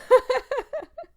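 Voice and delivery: high, loud gloating cackle; loud evil laugh. Acted. Player gloating over naive Milo, a loud high evil cackle. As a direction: cackle at his innocence.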